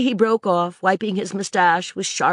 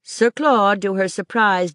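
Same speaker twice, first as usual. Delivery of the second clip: usual speaking voice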